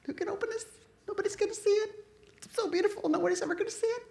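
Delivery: high, crying voice of John, pleading who can open it; high weeping John. Retold or imitated. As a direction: cry it out high and broken, almost in tears. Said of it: "he's crying".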